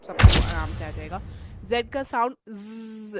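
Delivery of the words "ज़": sustained bee-like 'zzz'; buzzing Z. Shown as a demonstration. Teacher demonstrating Z, honey bee buzz. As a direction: hold a buzzing Z. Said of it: "जैसे honey bee का ज़".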